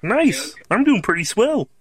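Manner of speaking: usual speaking voice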